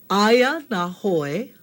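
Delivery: Aya na hoi drawn out very slowly; slow model phrase. Shown as a demonstration. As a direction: stretch Aya na hoi syllable by syllable. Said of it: "let's try it one more time".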